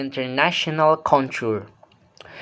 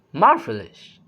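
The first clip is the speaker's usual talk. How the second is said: emphatic "marvelous", pitch swooping up then down; rise-fall tone. Shown as a demonstration. Teacher repeats the example, wider rise-fall on "marvelous". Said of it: "comes back down with emphasis".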